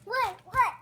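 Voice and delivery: ordinary talk, nothing marked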